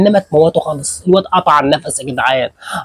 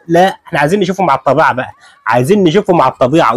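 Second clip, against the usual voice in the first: ordinary talk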